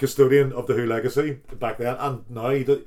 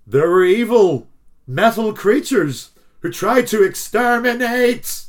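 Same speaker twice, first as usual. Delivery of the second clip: higher, louder dramatic verse reading; dramatic recital; 6 semitones higher, 5 dB louder, slower than usual. Acted. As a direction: declaim it with rising drama. Recites verse with high energy.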